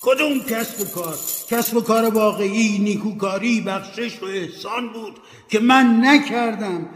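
high, loud lament; ghost's anguished cry. Acted. Ghost Marley cries out about his wasted life, loud and high. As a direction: wail it out in remorse. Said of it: "فریاد زد".

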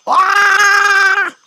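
long, high, loud "aah"; real squeal of amazement; excited scream. Real, not acted. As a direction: scream with joy at the prize. Sees red cannonballs reward.

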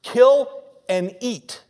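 drawn-out divine order; voice of God. Retold or imitated. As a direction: command it slowly from above. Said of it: "Then a voice said to him".

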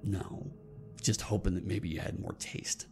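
low-pitched, quiet disdain; disdainful cat. Acted. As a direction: answer low with understated scorn.

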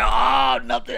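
loud, startled cover-up; mock scared. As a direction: blurt it like you've been caught. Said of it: "I got scared and shit".

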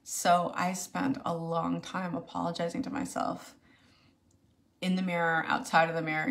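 ordinary talk

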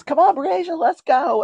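high, loud imitation of a boy calling. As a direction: call his sister along, eager and high. Retold boy calling his sister.

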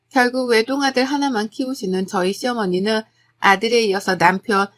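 ordinary delivery

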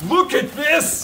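very high, excited exclamation; thrilled, high-pitched call to look; amazed outburst. Real, not acted. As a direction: squeal it in amazement. Amazed by yet more bags.

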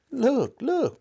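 high, loud excited cry; cried out. A narrator's reading. Boy spotting the ring cries out excitedly. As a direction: call out in sudden excitement. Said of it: "cried Diggory".

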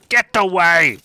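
put-on shouted in an Indian accent; Indian-accented get away. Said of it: "What did I sound Indian then?".

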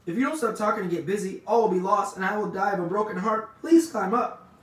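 high, loud frantic plea; desperate. A narrator's reading. Pig begs rat to climb, desperate. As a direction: plead at full pitch, building to the end. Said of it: "said Wilbur in desperation".

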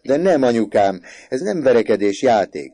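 loud, high pleading; insistent protest. A narrator's reading. Son explaining the game to mother, insistent protest. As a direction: insist on it at full voice.

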